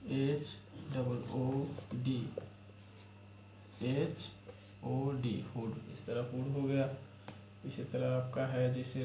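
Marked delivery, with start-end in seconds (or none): 0.1-5.3 letters drawn out slowly, one by one; teacher spelling hood for pupils, slow and deliberate. Shown as a demonstration. धीमी, एक-एक अक्षर वाली वर्तनी.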